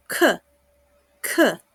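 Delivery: "k" with a strong breathy burst; aspirated k. Shown as a demonstration. Teacher demonstrating the strong Mandarin k sound. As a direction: release a hard puff on "k". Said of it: "la ka es más fuerte".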